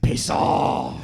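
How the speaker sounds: mock-angry dad outburst; hammed-up gruff dismissal; knee-jerk dismissal. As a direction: fire it off like a dad's reflex. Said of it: "his knee-jerk reaction to everything is".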